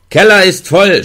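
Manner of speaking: viel höher und lauter geschrien; Schrei. A narrator's reading. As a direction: schrei es durch die Tür. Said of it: "schrie ich". (Gefangener schreit zum Wärter, laut und hoch)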